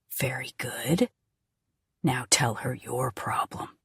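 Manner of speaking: low, soft, chilling approval; cold soft menace. Acted. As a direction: purr the threat quietly. Captor coaxing coldly.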